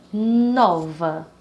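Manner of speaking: "nova" slowly, with a long strong "no"; stress on first syllable. Shown as a demonstration. Teacher giving an example word, first syllable stressed in "nova". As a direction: lean hard on the "no" of "nova". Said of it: "Então, a sílaba nó".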